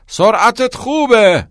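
high, loud, drawn-out coach's yell; shouting. A narrator's reading. Coach encourages a runner, shouting slowly. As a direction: stretch the shout across the gym. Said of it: "و داد زد".

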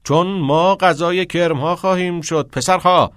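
loud, high, impassioned teacher's cry; shouting. Acted. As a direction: cry out and lecture the boys urgently. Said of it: "کتینگ فریاد زد".

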